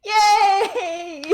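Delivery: high, gleeful cry of victory; excited cheer. Real, not acted. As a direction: cheer it out with glee. Celebrating a spider kill.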